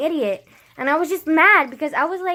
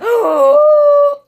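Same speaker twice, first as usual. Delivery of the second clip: sharp, high, loud theatrical gasp; big shocked gasp; 12 semitones higher, 12 dB louder than usual. Retold or imitated. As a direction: suck in air in mock outrage. Acting out shocked reaction to friend.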